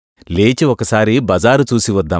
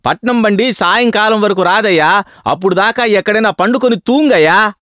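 put-on higher, scolding Tamil-accented clerk; angry clerk; 8 semitones higher than usual. A narrator's reading. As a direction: glare and tell him off in broken Telugu. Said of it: "కళ్ళెర్రజేసి".

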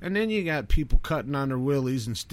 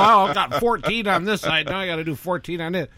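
mocking voice; 5 semitones higher, 5 dB louder, faster than usual. Mocking heavily pierced people.

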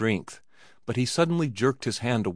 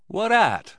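high, louder exclaimed question; astonished cry; 11 semitones higher, 5 dB louder than usual. A narrator's reading. Johnny and cowboy questioning the Easterner, crying out. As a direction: cry out the question, pitched well up. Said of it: "cried Johnny and the cowboy together".

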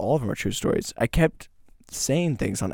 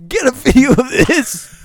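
high, loud, drawn-out shout through laughter; calling out; 10 semitones higher, 7 dB louder, slower than usual. Pretend. Host calling to be filmed, laughing shout. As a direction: holler it slowly, half laughing.

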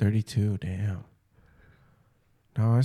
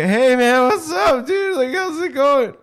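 very high, loud squeaky greeting; voice jumping up; 8 dB louder than usual. Shown as a demonstration. Showing how his voice suddenly changes, high and squeaky. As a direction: let the voice leap into a squeak. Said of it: "And then it's like".

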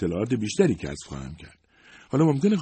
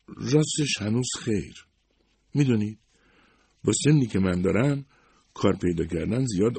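a higher, gentle secretary voice; lightly voiced Ms Barton; 7 semitones higher, 4 dB louder than usual. A narrator's reading. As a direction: play the loyal older secretary, modest and kind. (صدای کمی زیرتر خانم بارتون، منشی مهربان)

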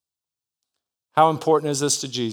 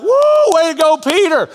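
very high, loud whoop of praise; mock cheer; 7 dB louder than usual. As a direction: whoop like a crowd cheering a teammate. Disciples cheering Peter.

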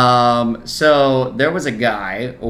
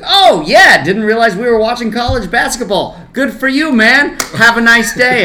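high, loud sarcastic heckle; jeering at a passing fan, pitched way up; heckling yell; 10 semitones higher, 7 dB louder than usual. Retold or imitated. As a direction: yell mock congratulations at a stranger.

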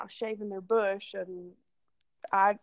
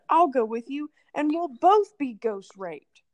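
louder, higher, gung-ho mock declaration; eager volunteering; 4 semitones higher, 8 dB louder than usual. Drunk friend volunteering to come along in the dark, mock-enthusiastic. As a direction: blurt it out like jumping up to volunteer. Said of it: "the other one jumped up and said".

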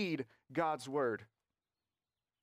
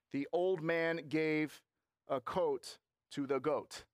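slow, measured word-by-word reading; careful child reader; slower than usual. Acted. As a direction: pronounce each word carefully, like a child learning to read. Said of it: "wanted to make sure he got the words right".

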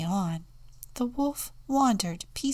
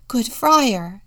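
insistent; 5 semitones higher, 10 dB louder than usual. A narrator's reading. Contessa answers friar.

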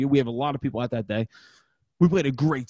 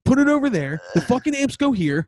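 angry rant; 4 semitones higher than usual. Retold or imitated. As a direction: bark orders at the lazy crew. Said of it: "and I said".

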